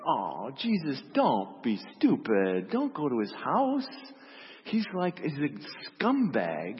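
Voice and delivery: pleading, with stretched high emphasis; exasperated protest. Acted. Disciples beg Jesus to avoid the scumbag's house, in exasperated protest. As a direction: plead with him, leaning hard on key words.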